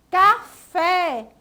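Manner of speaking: Café split in syllables, fé hit loud; stress on FÉ. Shown as a demonstration. Teacher quizzing pupils on strongest syllable, stressed fé in Café. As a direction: punch the final fé in Café. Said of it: "a sílaba mais forte dessa palavra".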